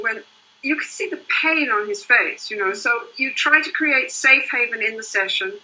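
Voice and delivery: ordinary talk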